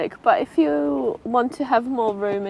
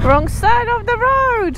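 raised, slow warning call; loud warning call; 13 semitones higher, 8 dB louder, slower than usual. Real, not acted. Warning rider on wrong side.

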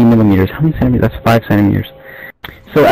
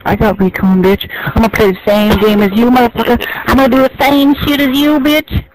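trash talk that climbs higher and higher into a yell; building taunt; 9 semitones higher than usual. Real, not acted. Gamer taunting a rival over voice chat, rising to a high angry yell. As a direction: start cocky, end shrieking the insults.

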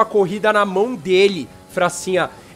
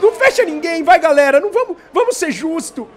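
shouting race call, higher; motorsport commentator; 6 semitones higher, 5 dB louder than usual. Real, not acted. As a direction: yell the three-wide like live TV. Live race play-by-play.

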